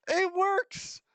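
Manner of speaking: very high, thrilled squeal; excited squeal. Real, not acted. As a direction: squeal with sudden joy. Repeating the success cry.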